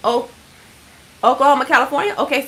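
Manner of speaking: usual speaking voice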